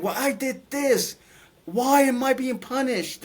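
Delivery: high, slow, bewildered lament; lamenting soul. Retold or imitated. Lost soul in darkness questioning its punishment, lamenting. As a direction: ask why you are punished, pained. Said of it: "Some were saying".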